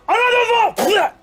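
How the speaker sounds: commander's shout. Acted. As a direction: yell the order across the battlefield. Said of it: "an archer commander says".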